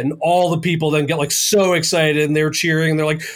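usual speaking voice

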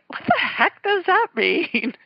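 high, slow puzzled "what the heck"; mock baffled wife. Wife mocking her southern husband's sayings in baffled voice. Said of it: "I'll be like".

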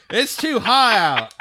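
high laughing lazy rich man; lazy rich old man. Retold or imitated. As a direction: ham up a lazy old money gent. Mocking an idle rich grandfather.

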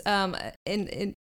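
ordinary talk

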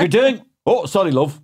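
high, loud, bad Welsh accent; badly faked Welsh lilt with laughter in it; terrible Welsh accent. Caught shower singer apologises to his love, bad Welsh accent. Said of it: "That's a terrible Welsh accent".

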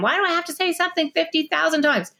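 high, whiny fed-up parent voice; exasperated parent. Retold or imitated. Parent quoting her own exasperated question. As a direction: mimic a parent at the end of their rope. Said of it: "This is the answer to the question".

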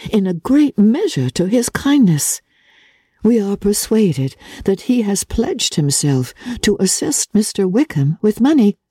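a hint of a sensible daughter calming her mother; Jane, gentle. A narrator's reading. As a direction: soothe her mother reasonably. Narrator voices Jane.

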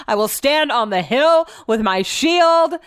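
mock his grand speech, drawn out; mocking imitation. Mocking his speech just played.

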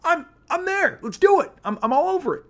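squeaky overeager yes; eager reply. Retold or imitated. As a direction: jump at the offer with big excitement. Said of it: "I said".

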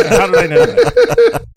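laughing through the words; high, laugh-filled teasing question. Real, not acted. Laughs while teasing the other speaker.

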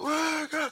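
squeaky ad lib yelp; high ad lib chant. Retold or imitated. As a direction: chirp it out like a DJ drop. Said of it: "it's like".

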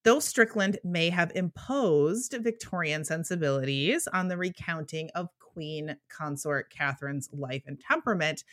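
ordinary talk

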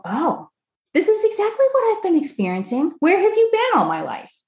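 high, thrilled imitation of a relieved customer; delighted customer. Guest voicing a customer who found her, delighted high voice. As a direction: squeal like you finally found the answer. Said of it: "people are gonna read your marketing and go".

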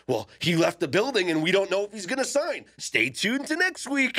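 rising, dramatic teaser read; TV promo voice. Retold or imitated. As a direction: hype it like a TV cliffhanger. Mock TV cliffhanger tease.

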